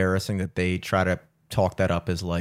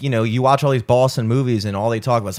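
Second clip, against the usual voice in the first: normal talking voice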